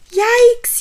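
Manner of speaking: loud, high startled yell; sudden frightened yelp. A narrator's reading. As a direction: jump out of your skin. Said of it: "yelled Hugly".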